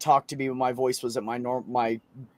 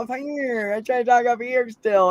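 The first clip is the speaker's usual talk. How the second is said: high voice; 10 semitones higher than usual. Retold or imitated. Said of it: "You know".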